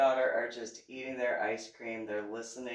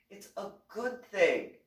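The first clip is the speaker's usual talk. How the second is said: mild reassuring explanation; 7 semitones higher, 4 dB louder than usual. Retold or imitated. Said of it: "Skabuda had to explain to me".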